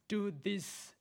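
ordinary talk, nothing marked

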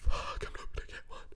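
breathless whispered panic; whispered thought. Retold or imitated. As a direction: hiss it to yourself in dread. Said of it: "I'm thinking".

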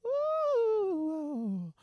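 high sung ooh, loud; a high vocal run on ooh. Shown as a demonstration. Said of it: "soft voice while I sing".

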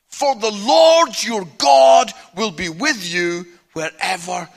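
slow, loud scripture proclamation; commanding, measured Bible reading voice; God's words declaimed. Acted. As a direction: proclaim each phrase as a divine command, slowly. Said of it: "he says these words".

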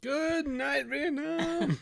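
very high, stretched-out holler; hammed-up announcer cry; mock radio sign-off. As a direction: draw it out like a manic DJ signing off. Parody of the film radio shout.

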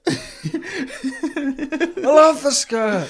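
put-on high, very slow, laughing boy voice; mock ghost boy. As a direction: drag it out, giggling, as the skirt-loving boy.

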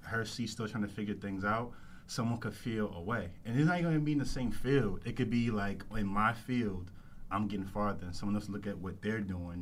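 ordinary talk

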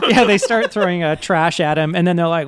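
ordinary talk, nothing marked

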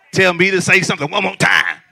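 loud, laughing mock dare to a churchgoer; mock challenge. Preacher voicing an irritated neighbor in church, laughing loud dare. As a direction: shout it back like a neighbor daring you to repeat it. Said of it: "they looked at you like".

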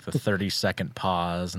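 ordinary talk, nothing marked

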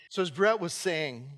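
ordinary talk

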